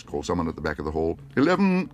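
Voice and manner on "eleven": higher, louder single bid; calling a bid. Acted. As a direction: call the bid over the crowd. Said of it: "called Mister Gruber".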